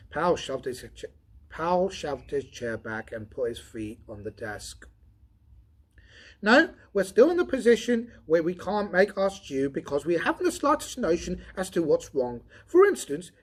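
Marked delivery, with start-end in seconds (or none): 6.4-13.3 mistaken take. Shown as a demonstration. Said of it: "sorry, I'll start that again".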